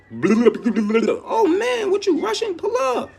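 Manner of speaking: playful high mock invite; excited mock welcome. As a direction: invite them in, hyped. Acting out welcoming newcomers.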